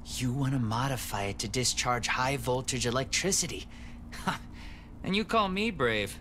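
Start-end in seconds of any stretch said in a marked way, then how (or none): 3.7-5.0 quiet breathy chuckle; soft laugh. Acted. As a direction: laugh softly, amused. Laughs at Kate's daring idea.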